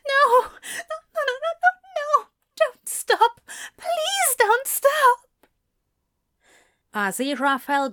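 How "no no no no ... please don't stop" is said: high, breathless desperate pleading; desperate cries. Acted. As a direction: cry out, begging. Aziraphale cries out in a dream.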